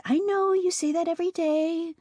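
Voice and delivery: high, weary little-sister voice; high childlike sister, bored; younger sister. A narrator's reading. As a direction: roll your eyes as a kid sister.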